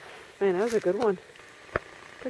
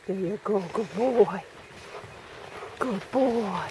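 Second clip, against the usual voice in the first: usual speaking voice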